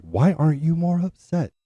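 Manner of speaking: high-pitched, pleading imitation of mom; flipping out. Mom confronting calm dad about the news, flipping out. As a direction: send the question soaring upward in distress. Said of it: "flipping out".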